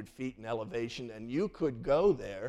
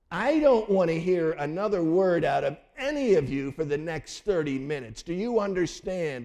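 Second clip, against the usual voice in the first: stern dad; 3 semitones higher, 6 dB louder than usual. Retold or imitated. Dad scolding kids after spanking.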